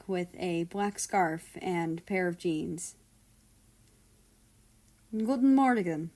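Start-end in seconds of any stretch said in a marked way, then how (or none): 5.1-6.1 put-on thick Russian accent. A narrator's reading. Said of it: "greeted with a thick accent".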